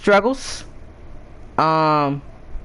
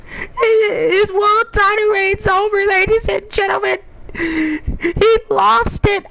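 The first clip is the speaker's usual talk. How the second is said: mock crying commentator; 11 semitones higher than usual. Commentator pretending to sob over a title loss, hammed-up. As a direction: wail like the title loss is a tragedy. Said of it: "I might be like".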